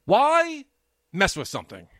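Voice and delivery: WHY jumps high and loud, then drops; exasperated outburst. Retold or imitated. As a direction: yelp the why in frustration. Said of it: "I'm like".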